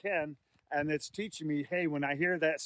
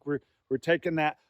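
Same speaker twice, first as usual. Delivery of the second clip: usual speaking voice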